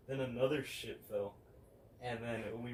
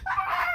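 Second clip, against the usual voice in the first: sudden high shriek, much louder; retold scream; 14 dB louder than usual. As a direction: mimic mom's startled scream at full volume. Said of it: "she just went".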